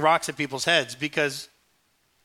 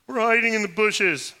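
a higher, louder answer from the bushes; sheepish Adam; 7 semitones higher, 7 dB louder than usual. Acted. Adam answering God from the bushes, raised and sheepish. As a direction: admit it from behind a bush.

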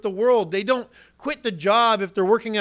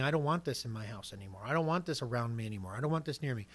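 much softer, firm but hushed; quiet resolve; 7 semitones lower, 11 dB quieter, faster than usual. Retold or imitated. Preacher voicing a penitent quietly rejecting sin, hushed. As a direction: say it low, meaning every word. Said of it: "It's saying".

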